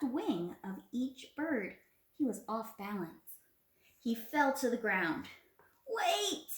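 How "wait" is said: high, loud cry of wait; cried out. A narrator's reading. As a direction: call out in alarm as Coyote falling. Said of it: "he cried out".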